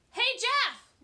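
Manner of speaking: loud calling shout across the street. A narrator's reading. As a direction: call out to him at full voice. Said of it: "Lori shouted".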